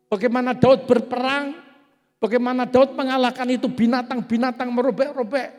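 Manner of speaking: loud, high and driving, building on each question; fiery preaching. Acted. Pastor to congregation about David, loud fiery preaching.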